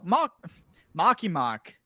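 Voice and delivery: put-on Boston accent. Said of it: "can't pronounce R's".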